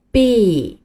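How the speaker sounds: "B" stretched into a long bee; 拉长声音读B; drawn-out B. Shown as a demonstration. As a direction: hold the ee of "B" out. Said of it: "要把声音拉长".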